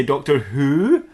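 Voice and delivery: "Doctor Who?" drawn out with a rising end; questioning. Acted. A later character asks who the Doctor is, stretching "Who?" as a rising question. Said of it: "will ask who I am and say".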